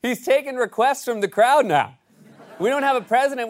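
usual speaking voice

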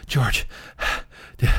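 winded, gasping between words; hammed-up out of breath; acted panting. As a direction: puff as if you just ran out here. Family arrives after running out.

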